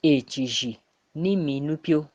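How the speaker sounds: usual speaking voice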